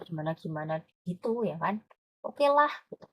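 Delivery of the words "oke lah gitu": unimpressed. Shown as a demonstration. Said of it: "Jadi nggak terlalu puas".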